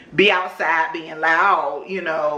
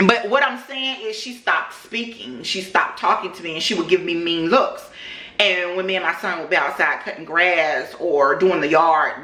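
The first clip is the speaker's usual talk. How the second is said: normal talking voice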